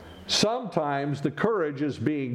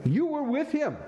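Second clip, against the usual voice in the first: accusing bystander; 12 semitones higher than usual. Acted. Bystander accuses Peter at fire.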